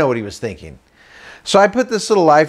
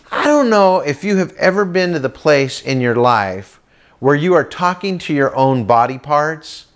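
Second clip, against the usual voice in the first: normal talking voice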